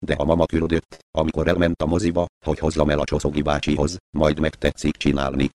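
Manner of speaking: usual speaking voice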